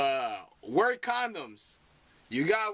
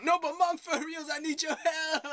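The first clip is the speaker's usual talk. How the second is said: high, pleading son in a bad British accent; high-pitched failed British accent; botched British accent; 10 semitones higher than usual. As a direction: plead with mom in a badly faked accent. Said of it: "Wrong accent. I'm bad at accents".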